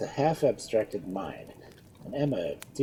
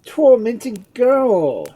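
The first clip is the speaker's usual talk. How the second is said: loud, very slow, high exclaiming; exclamation; 14 semitones higher, 14 dB louder, much slower than usual. A narrator's reading. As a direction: draw it out in passionate exasperation. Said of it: "exclaimed Edmund".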